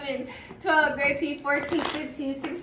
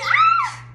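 high yelp of excitement; excited scream; 9 dB louder than usual. Retold or imitated. Reader recalling her reaction, excited high yelp. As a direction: let out a sudden high shout. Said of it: "I was like".

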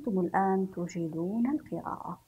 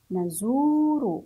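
نزور joined as one word, long zuu; نزور in one slow, connected sweep; blended slowly; 3 semitones higher, 4 dB louder, much slower than usual. Shown as a demonstration. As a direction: say نزور whole but stretched. Same word then blended whole.

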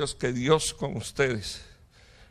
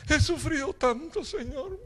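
high, hammed-up whimper; mock self-pitying prayer; 12 semitones higher than usual. A self-pitying believer prays to the Lord, mock whimpering. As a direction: whine it piteously in a thin high voice. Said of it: "en la oración agarran una rosa".

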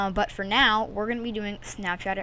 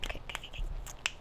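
whispered nervous okays; nervous whisper to calm down; scared whisper; 12 dB quieter, much faster than usual. Real, not acted. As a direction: breathe it, voiceless and nervous. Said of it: "I'm scared".

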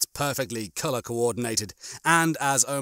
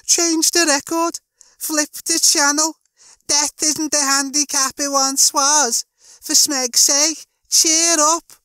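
very high, loud mock Scouse; fake Scouse accent; 5 dB louder than usual. Rimmer mocks Lister to cheer up, in fake Scouse. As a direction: put on a squawking, hammed-up Scouse accent. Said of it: "He put on a fake Scouse accent".

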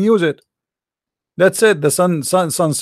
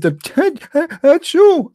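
high fake achoo; a playful put-on sneeze; mock sneeze; 8 semitones higher than usual. Acting out Adam sneezing, a high mock achoo.

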